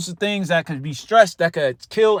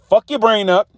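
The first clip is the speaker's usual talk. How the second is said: normal talking voice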